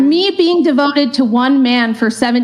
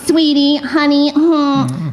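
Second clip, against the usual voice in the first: mocking sassy tone; much slower than usual. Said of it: "I love these feminists are always".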